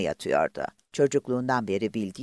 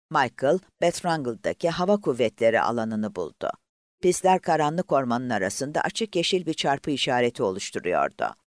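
plain reading voice

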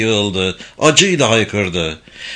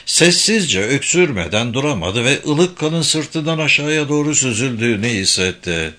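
plain reading voice

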